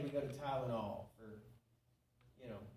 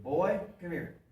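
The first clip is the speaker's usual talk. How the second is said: put-on louder, firm call to the boy; gruff granddad call; 3 semitones higher, 11 dB louder, slower than usual. Granddad calls the young boy over, loud and firm. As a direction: bark it out like an old granddad summoning a kid.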